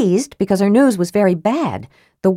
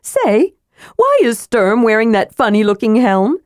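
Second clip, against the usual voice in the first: raised voice; 5 semitones higher than usual. Acted. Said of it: "Tass raised his voice to be heard".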